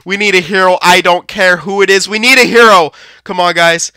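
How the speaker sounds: ordinary talk, nothing marked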